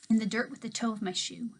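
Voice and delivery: ordinary delivery, nothing marked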